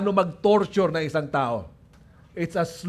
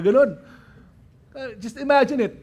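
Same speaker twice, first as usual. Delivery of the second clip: ordinary delivery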